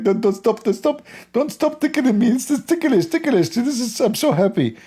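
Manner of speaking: very high giggly pleading; squealing tickled god. Retold or imitated. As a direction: beg in a shrill tickled squeal. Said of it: "You know, like".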